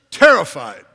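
terrified punched out loud at the peak. Acted. Preacher driving home the disciples' terror, loud. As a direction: hit terrified hard and loud. Disciples fall on their faces.

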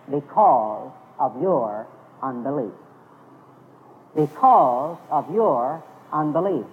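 slow, low and measured, word by word; solemn pronouncement. Retold or imitated. Preacher quoting Jesus's answer to the disciples, solemn and slow. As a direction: let each word land slowly and weightily.

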